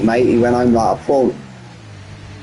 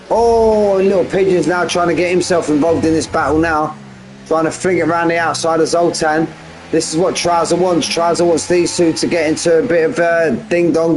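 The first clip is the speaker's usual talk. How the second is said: raised, lively play-by-play call; pitched-up race caller; race commentary; 5 semitones higher than usual. Acted. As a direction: call the battle like a live commentator.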